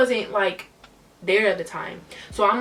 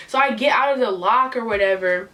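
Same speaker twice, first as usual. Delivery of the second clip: usual speaking voice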